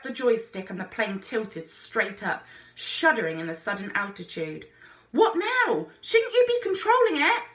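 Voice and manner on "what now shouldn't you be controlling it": high, loud panicked cry; scared boy calling to the pilot; panicky. A narrator's reading. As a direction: yelp it in alarm. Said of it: "Fred's voice sounded panicky".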